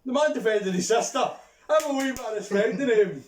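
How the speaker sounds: high, loud rebuke; indignant protest. Real, not acted. Sticking up for Maguire to mate, loud indignant protest. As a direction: jump in loudly to defend him.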